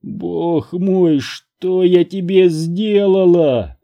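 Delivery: high, drawn-out anguished wail; bursting into sobs. A narrator's reading. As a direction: break down as you ask. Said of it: "разрыдался я".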